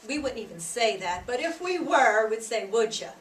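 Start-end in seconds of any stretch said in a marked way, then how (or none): 2.7-3.1 "Would ya?" with "you" reduced to "ya" and joined to "would"; casual American "Would ya?"; run-together "would ya". Shown as a demonstration. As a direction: smush "Would ya?" into one quick word. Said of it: "smushing our words together".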